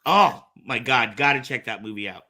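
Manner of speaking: normal talking voice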